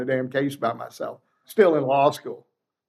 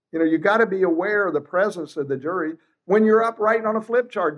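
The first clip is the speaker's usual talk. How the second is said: ordinary delivery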